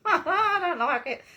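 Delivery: high, loud laughing protest. Real, not acted. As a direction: squeal it through a laugh, slowly. Recoils laughing from the camera.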